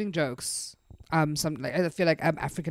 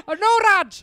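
mock gang voice; 14 semitones higher, 9 dB louder than usual. Mock gang scene, laughing.

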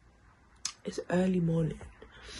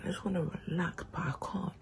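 whispering; 9 dB quieter than usual. Real, not acted. Quiet morning, others asleep.

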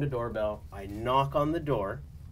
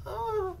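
much higher mock moan; imitated moaning; 4 dB quieter than usual. Agent mimicking what she heard in the hallway, mock moaning. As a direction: give a high imitation moan. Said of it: "all I hear is".

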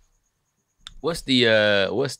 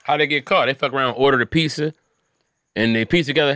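ordinary talk, nothing marked

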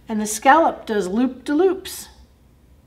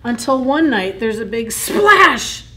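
building suspense, louder; dramatic, louder; 3 semitones higher, 7 dB louder than usual. A narrator's reading. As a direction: bring the big splash in loud. Dramatic storytelling turn.

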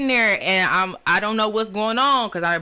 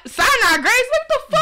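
much higher, louder what the fuck; shocked disbelief; 13 semitones higher, 4 dB louder than usual. Retold or imitated. Realizing which hospital she woke in, high loud shocked disbelief. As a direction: shriek it in disbelief.

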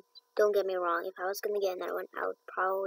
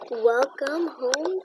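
warm; 3 semitones higher, 4 dB louder, much slower than usual. Real, not acted. Greeting the new horse.

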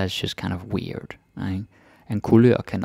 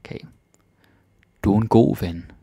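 ordinary talk

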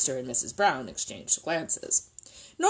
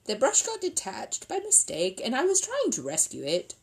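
high, mildly plaintive explanation; small, raised, apologetic bear voice; sad; 7 semitones higher than usual. Acted. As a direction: explain yourself sorrowfully. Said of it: "said Paddington sadly".

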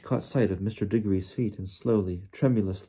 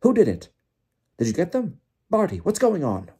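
high, loud urgent questions; worked-up, high-pitched demand; Bagman agitated; 9 semitones higher, 6 dB louder than usual. A narrator's reading. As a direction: fire out frantic questions, voice pitched up. Bagman arrives agitated, demanding answers.

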